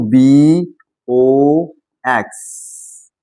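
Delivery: बी ओ x stretched out letter by letter; slow spelling out. Shown as a demonstration. Teacher spells box for learners, बी ओ x drawn out very slowly. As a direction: draw out बी and ओ, pausing before x.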